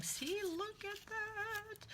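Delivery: high, soft, slow delighted squeal. Real, not acted. Delighted at masking paper find.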